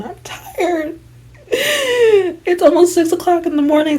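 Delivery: high, mock-crying whine; high-pitched weary wail; whiny exhaustion. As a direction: whine it like you're tearfully worn out. Whining about exhaustion, mock tearful.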